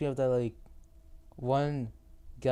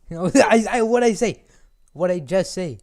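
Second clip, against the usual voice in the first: loud, high-pitched pretend telling-off; mock scolding; 7 semitones higher, 12 dB louder than usual. Rebuking the squeaky character, mock-angry. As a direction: raise the voice in mock exasperation.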